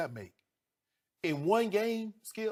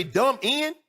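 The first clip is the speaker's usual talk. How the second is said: "dumb end" pitched high and loud with a rising question tone; loud, disbelieving repeat of "dumb end"; incredulous echo; 10 semitones higher, 6 dB louder than usual. Retold or imitated. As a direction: throw "dumb end" back at him in disbelief. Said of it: "you gonna say".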